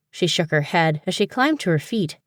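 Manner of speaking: ordinary delivery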